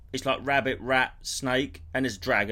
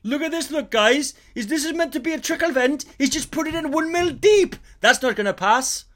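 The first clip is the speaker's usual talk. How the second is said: high, loud Welsh snagging inspector; Welsh builder impression; 10 semitones higher, 5 dB louder than usual. Impression of the Welsh snagging guy tutting at a new build. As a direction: pick apart a shoddy build in Welsh. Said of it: "You're very good at an impression of him".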